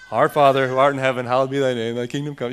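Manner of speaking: fast, mindless recital of the Lord's Prayer; rattled-off rote prayer. Retold or imitated. Pastor mocking how the congregation recites it, fast and mindless. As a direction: rattle it off like a memorized chant. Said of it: "what he told us not to do and do exactly that with it".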